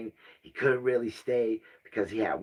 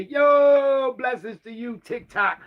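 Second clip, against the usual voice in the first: shout-out; 10 semitones higher, 6 dB louder, slower than usual. Real, not acted. Shout-out to TikTok.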